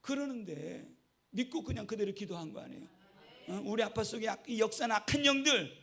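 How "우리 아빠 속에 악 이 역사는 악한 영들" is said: loud, commanding deliverance prayer; boy commanding the spirit to leave, loud; fervent rebuke prayer. Retold or imitated. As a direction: order the spirit out. Retold child's prayer command.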